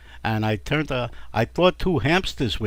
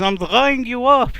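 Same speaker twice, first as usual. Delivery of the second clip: strained husband; 11 semitones higher, 6 dB louder, slower than usual. Acted. Husband voiced.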